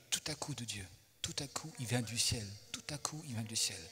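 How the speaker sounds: quiet, awed repetition; hushed wonder. Real, not acted. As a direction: drop the voice as if sharing a marvel. Hushed wonder at sudden divine help.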